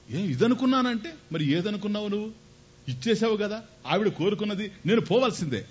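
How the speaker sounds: high, pleading then firm; Rama's resolute reply. Acted. Rama tells his father he must go, high and earnest.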